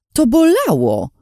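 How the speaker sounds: loud, high protesting yelp; shout. A narrator's reading. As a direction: cry out that it hurt, half laughing. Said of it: "krzyknęłam". (głośny, wysoki okrzyk protestu)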